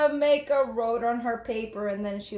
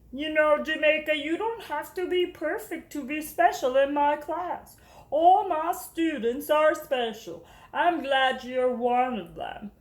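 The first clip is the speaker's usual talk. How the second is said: reassuring teacher; 4 semitones higher than usual. Acted. Teacher telling Jamaica she is special, gently. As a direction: comfort her gently.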